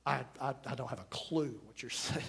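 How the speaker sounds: barely voiced, as if someone can't hear you; whispering. Shown as a demonstration. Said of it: "whispering to me".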